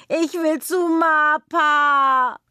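hoch, langsam, weinerlich gedehnt; schluchzt. Acted. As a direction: jammernd schluchzen, sehr gedehnt. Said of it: "schluchzt sie". (Kind schluchzt hoch und langsam nach Mapa)

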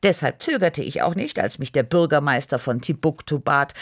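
ordinary delivery

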